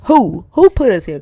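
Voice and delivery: WHO repeated and punched loud; stress on WHO. Real, not acted. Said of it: "instead of why".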